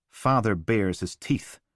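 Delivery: ordinary delivery, nothing marked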